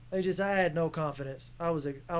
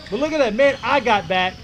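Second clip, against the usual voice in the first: loud, high bragging about his own hustle; excited boast; 4 semitones higher, 9 dB louder than usual. Real, not acted. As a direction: crow proudly over the replay. Proud of his own defense.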